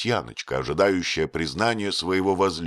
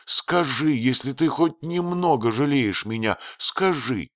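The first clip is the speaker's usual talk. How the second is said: higher-pitched, understated entreaty; pleading; 6 semitones higher than usual. A narrator's reading. Wife begs husband for a little pity, pleading. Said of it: "взмолилась она".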